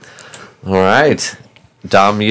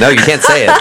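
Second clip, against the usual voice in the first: usual speaking voice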